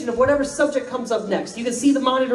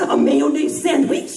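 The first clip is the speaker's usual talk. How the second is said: put-on slow, scoffing British accent; mock British accent; 5 semitones higher, 4 dB louder, slower than usual. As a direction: sneer it slowly in a British accent. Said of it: "and I'm gonna try their accent".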